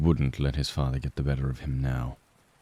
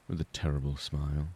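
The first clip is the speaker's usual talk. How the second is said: ordinary delivery, nothing marked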